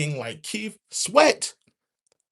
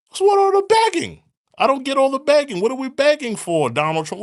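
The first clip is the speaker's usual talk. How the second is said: much higher, loud and fast; rapid exasperated shout; 5 semitones higher, 8 dB louder, faster than usual. Real, not acted. Ranting about the begging, fast and loud. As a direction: fire it off in outrage.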